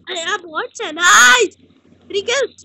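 sudden loud high yell; loud high gamer outburst; excited outburst. Real, not acted. Sudden outburst mid-game.

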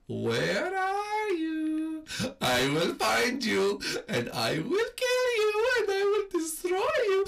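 slow, high sing-song threat; menacing killer. Pretend. Gamer as the murderer taunting Toad, slow and menacing. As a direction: taunt the prey like a movie killer.

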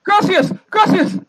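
high frantic stammered gracias; stammering thanks. Acted. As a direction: gush gracias over and over. Man thanks in Spanish.

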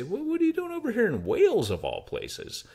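high, surprised local asking; lightly voiced curious Welsh customer. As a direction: play the puzzled local at the counter. Locals asking about his accent.

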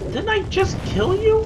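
very high, incredulous cry; disbelieving squeal. Real, not acted. Player squeals at a gargoyle that came back, very high.

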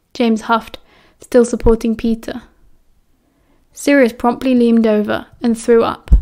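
ordinary delivery, nothing marked

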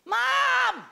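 lightly voiced teen calling from the shower; teen son calling. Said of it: "From the shower, I hear".

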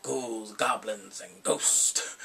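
low, slow eerie intonation; low slow mock-spooky line; spooky movie voice. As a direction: drag it out deep like a horror host. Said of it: "he's like".

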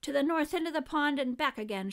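high, projected referee call; referee's shout. A narrator's reading. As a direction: call out the course to the racers. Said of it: "shouted the referee".

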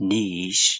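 niche as "neesh"; long ee, then sh in niche; British niche. Shown as a demonstration. Teacher builds niche from its sounds, ending on sh. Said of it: "ရှအသံလေးနဲ့".

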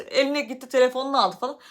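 usual speaking voice

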